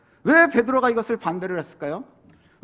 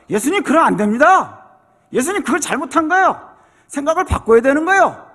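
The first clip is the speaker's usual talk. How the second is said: louder, higher, scolding his master; scolding rebuke; 5 semitones higher, 6 dB louder than usual. Acted. Peter grabbing Jesus and scolding him, loud rebuke. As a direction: scold Jesus like a stubborn disciple. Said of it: "막 혼내요".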